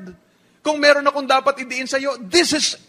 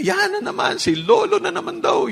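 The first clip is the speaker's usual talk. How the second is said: put-on higher whiny teen complaint; mock annoyed kid; 9 semitones higher than usual. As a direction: roll your eyes as the bored kid. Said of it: "Sabihin mo".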